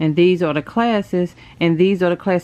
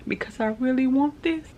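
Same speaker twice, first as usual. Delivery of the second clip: about to cry; 5 semitones higher than usual. Real, not acted. Vlogger admitting she really wants this, real near-tears voice. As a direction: voice climbing as tears come. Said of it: "I'm about to cry again".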